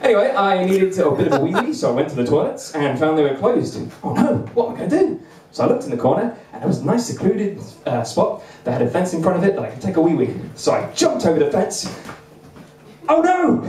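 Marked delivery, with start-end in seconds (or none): 4.0-5.2 high, mock-desperate question; a hint of silly panic. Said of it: "panic at closed toilets". 13.1-13.6 high, loud mock cry; mock alarm. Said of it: "trousers snagged on fence".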